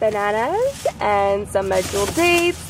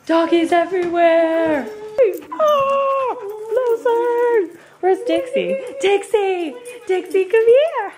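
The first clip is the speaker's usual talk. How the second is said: doggy voice; 10 semitones higher, slower than usual. Real, not acted. Home again and greeting her dogs, high baby talk. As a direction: coo at the pets like babies.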